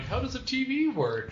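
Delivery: high, baffled question; lost out of his own time; confused man from the past. Retold or imitated. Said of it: "Brendan Fraser, like".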